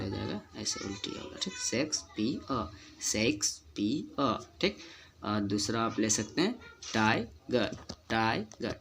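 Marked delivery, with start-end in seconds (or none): 1.6-4.4 slow शेक्सपियर in beats, शेक्स-पी-अ; spaced-out model reading of शेक्सपियर; correct silent-r pronunciation. Shown as a demonstration. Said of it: "सारा silent होकर आपको आ का sound". 6.8-8.7 टाइगर as टाइ-ग, no final र; slow model टाइगर without the r; correct silent-r pronunciation. Shown as a demonstration. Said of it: "ईआर silent रहता है".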